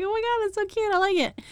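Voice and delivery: thrilled fangirl squeal; excited gush. Retold or imitated. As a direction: squeal over how cute it is. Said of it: "I was like".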